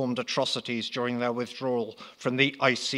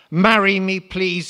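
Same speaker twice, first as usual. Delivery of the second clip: higher, louder pleading plea; begging; 7 semitones higher, 10 dB louder than usual. Acted. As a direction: beg her, voice lifted and loud. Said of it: "he begged".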